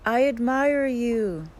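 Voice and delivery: mountain's echo, high, loud and slow; echoed shout. A narrator's reading. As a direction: call it back in long drawn-out words. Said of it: "The voice answers".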